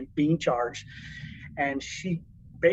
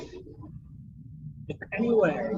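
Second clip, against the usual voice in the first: drawn-out pleading; 3 semitones higher, 4 dB quieter, much slower than usual. Retold or imitated. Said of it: "And I said".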